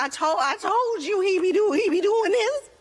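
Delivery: high-pitched stuttering tattle; hammed-up wounded protest; whiny complaint. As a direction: imitate an exasperated husband whining. Upset husband complaining about son.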